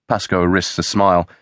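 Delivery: ordinary delivery, nothing marked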